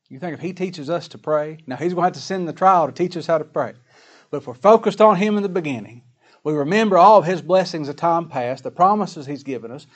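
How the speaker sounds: ordinary delivery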